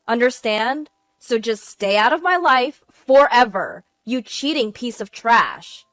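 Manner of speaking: loud, forceful angry threats; wife erupting at her cheating husband; furious tirade. Acted. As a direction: tear into him and cut him off. Wife's furious final threats to husband.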